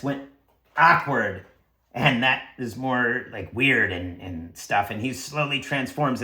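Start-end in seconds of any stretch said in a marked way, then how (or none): none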